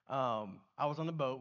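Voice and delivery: ordinary talk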